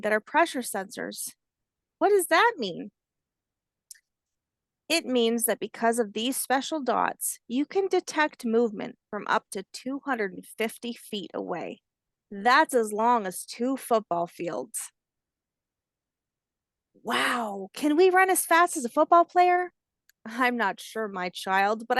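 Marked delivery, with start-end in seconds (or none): 2.0-2.9 higher, a lightly voiced curious child; curious hatchling. Hatchling asks what pressure sensors mean, lightly childlike. 17.0-19.7 higher and louder, an eager little voice; excited hatchling. Acted. Hatchling asks grandmother about running fast, excited childlike voice.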